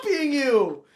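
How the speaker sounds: high, slow, outraged denial; indignant protest. Real, not acted.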